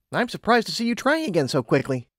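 lightly acted surprise; lightly voiced Twilight. A narrator's reading. Twilight remarking on Lumina trying again, mildly surprised.

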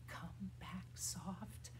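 near-whispered call; soft. Shown as a demonstration. Teacher calling the bird named Soft back, whispery soft. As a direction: barely voice it, very gently.

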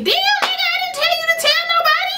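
very high, squealed complaint; rushed high-pitched indignation, acted out; exasperated mock outburst. Girl silently cursing blabbing cousin, exasperated. Exasperated thought at Ray telling everyone.

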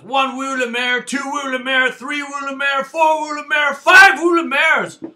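building count-up shout. Real, not acted. Beer reviewer shouting out his rating to viewers, climbing to a yell. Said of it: "the rating on this one is".

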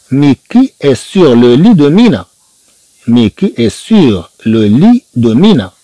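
slow, syllable by syllable French; slow model reading. Shown as a demonstration. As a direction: read it slowly for students to repeat. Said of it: "teacher models slow French reading".

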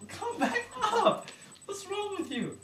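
mock call. Calling back fleeing patient.

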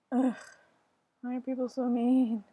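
usual speaking voice

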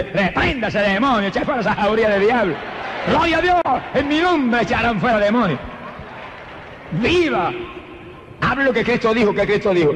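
high-pitched, urgent exhortation; fired-up preaching. Real, not acted. Preacher urges congregation to cast out demons, fired-up high-pitched preaching. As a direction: rebuke the demons with soaring fervour.

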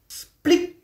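high, sharp splat sound; a sudden high splic; splat noise. Acted. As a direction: squeak out the splat. Dropping falls on mole's head.

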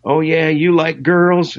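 high, slow mock teasing. [name] teases guitarist in headlock, high mock voice. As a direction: needle the young guy playfully. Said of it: "he says".

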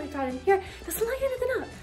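normal talking voice

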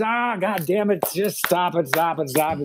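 swat the praise away, grumbling; ornery grandma. Retold or imitated. Grandmother batting off her grandson's praise, mock grumpy.

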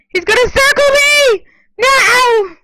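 high, loud alarmed cry; panicked shout. Real, not acted. Gamer about to be circled in the game, panicked shout. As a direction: shout in panic as you are trapped.

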